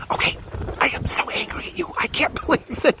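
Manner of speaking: forced, mock-furious whisper; hammed-up angry stage whisper. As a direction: whisper it as if trying to stay angry. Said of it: "you cannot be angry and whisper".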